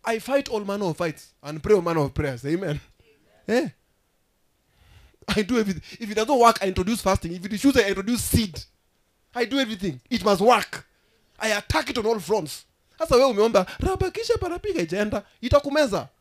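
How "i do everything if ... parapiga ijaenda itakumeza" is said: loud, high, driving sermon delivery; full-force preaching. Acted. Preacher declaring attack on all fronts, forceful preaching. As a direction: hammer out each line like an attack.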